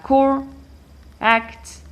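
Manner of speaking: CORE, then ACT after a gap; split into syllables. Shown as a demonstration. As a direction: say core and act as two separate pieces.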